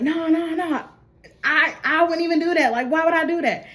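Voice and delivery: imitating a caught man scrambling to deny; panicked denial. JB denying to Dutch that he stole, high and pleading. JB denies stealing.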